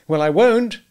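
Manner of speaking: high, loud, angry refusal; indignant refusal. A narrator's reading. Husband telling wife he won't take it, angrily.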